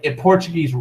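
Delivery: normal talking voice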